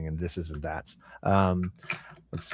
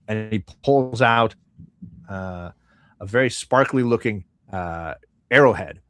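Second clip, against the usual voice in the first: ordinary delivery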